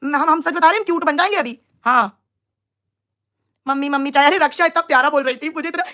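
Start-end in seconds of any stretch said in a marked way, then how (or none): none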